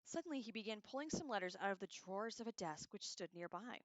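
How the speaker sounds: ordinary delivery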